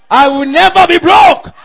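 high, loud cry rising at the end; defiant shout. Real, not acted. Preacher shouts declaration of faith.

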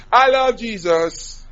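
drawn-out, high cry of love; shouted declaration. Retold or imitated. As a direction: belt it out slowly to obey the command. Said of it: "shout it".

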